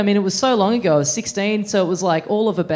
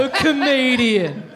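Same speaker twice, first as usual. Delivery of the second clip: dry, slow, sarcastic delivery; 3 semitones higher, slower than usual. Shown as a demonstration. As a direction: drawl it with heavy irony. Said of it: "I said that with such sarcasm".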